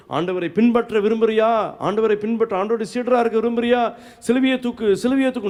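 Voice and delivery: high, insistent preaching; preacher's exhortation. Retold or imitated. Priest urging listeners to carry the cross, insistent exhortation.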